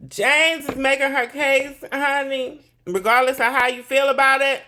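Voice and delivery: loud, high-pitched proclamation; emphatic declaration. Real, not acted. Host repeating the verdict on the case to viewers, loud and emphatic.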